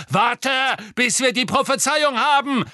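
high, loud, impatient bellow; snapped order. A narrator's reading. Malfoy restraining Bellatrix, snapping angrily. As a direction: shout down the raving ally. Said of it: "schnauzte Malfoy".

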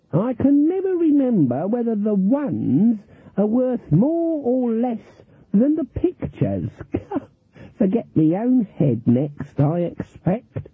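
put-on high, slow, dithering old lady; Granny Weatherwax feigning innocence at cards; mock-innocent old granny. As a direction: play the confused harmless granny. Granny plays dotty old woman.

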